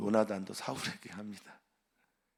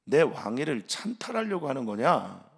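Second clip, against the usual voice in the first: angry accusation; 2 semitones higher, 6 dB louder than usual. Acted. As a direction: demand it like a suspicious king. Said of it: "이렇게 말하는 거예요".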